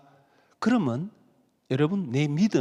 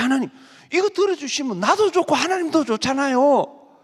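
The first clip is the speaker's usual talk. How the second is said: mock wheedling; 8 semitones higher, 5 dB louder than usual. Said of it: "막 그러면서요, 따지기도 하고".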